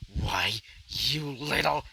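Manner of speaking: ordinary delivery, nothing marked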